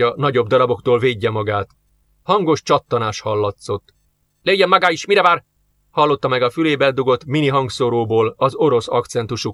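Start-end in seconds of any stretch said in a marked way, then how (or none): 4.4-5.4 higher-pitched urgent call; a contained shout, pressing him to act. A narrator's reading. Russian officer over earpiece to policeman, urgent shout. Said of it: "kiáltást".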